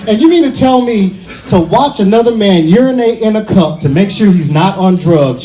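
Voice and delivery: usual speaking voice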